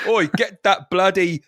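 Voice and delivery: slow, high cockney telling-off; angry East End Yoda. Acted. Yoda ordering an android off, angry East Ender. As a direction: have a go like an angry geezer. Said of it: "East End guy as a, as Yoda".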